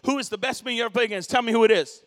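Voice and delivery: fast, loud, demanding; pushy reporter shout. Retold or imitated. Reporter yelling his question in the locker room, fast and loud. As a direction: push hard for the name, almost yelling.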